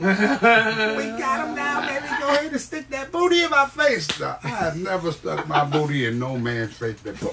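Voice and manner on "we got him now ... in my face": put-on high old-lady voice, chuckling; old lady in the crowd cheering him on; big mama voice. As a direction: cheer it like a delighted granny. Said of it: "his voice".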